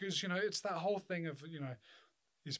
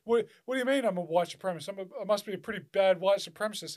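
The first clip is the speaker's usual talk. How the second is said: mocking conservatives; 3 semitones higher, 6 dB louder than usual. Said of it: "'Cause they go".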